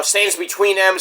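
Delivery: ordinary talk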